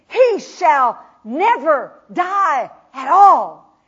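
loud, slow, spaced-out declaration; loving proclamation. Acted. Jesus declaring to Martha, loud and slow. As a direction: call her name then hammer each word slowly. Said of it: "remember, he loves her".

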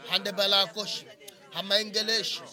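ordinary delivery

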